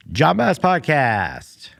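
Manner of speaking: high, drawn-out announcer yell; calling out. Pretend. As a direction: holler the show name for next door to hear. Calls out so neighbour hears.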